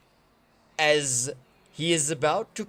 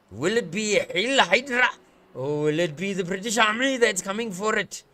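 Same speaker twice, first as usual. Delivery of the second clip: usual speaking voice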